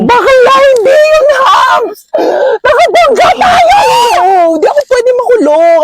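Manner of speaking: high, loud frantic cry; panicked yelling. Acted. Friend realizing they hit a person, panicked crying shout. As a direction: shout it near tears. Panic after hitting someone.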